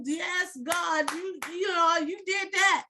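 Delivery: high, loud, jubilant cry to God; re-enacted praise shout. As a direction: shout your thanks up high, replaying the moment. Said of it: "You know, I'm shouting".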